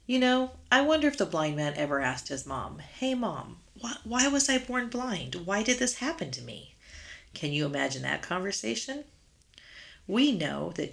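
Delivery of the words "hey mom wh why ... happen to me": put-on higher, pleading child's voice; child asking mom. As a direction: voice a young boy asking his mother. Blind boy asking his mom.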